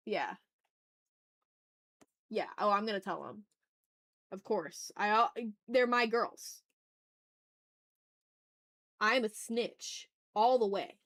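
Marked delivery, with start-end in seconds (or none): none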